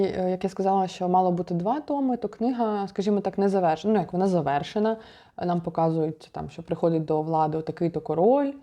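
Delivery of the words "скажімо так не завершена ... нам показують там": ordinary delivery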